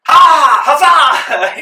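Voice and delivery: huge high-pitched mock war cry; hammed-up soldier's huzzah; battle cheer. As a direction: whoop it out at full volume. GM joins the players' battle cry.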